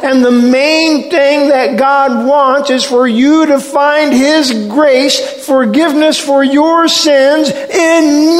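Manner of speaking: high, loud preaching cry as Jesus; impassioned plea. Acted. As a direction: build to a full-voiced shout, stretching the last words. Preacher voices Jesus pleading at climax.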